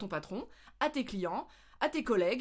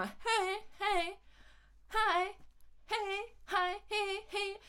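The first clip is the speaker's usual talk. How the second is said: much higher squeaky hey, hi; mumbled hey; 10 semitones higher, much slower than usual. Shown as a demonstration. Hikers' greetings worn down to squeaky high hey. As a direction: blurt tiny high greetings. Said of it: "ça ressemble plus à rien".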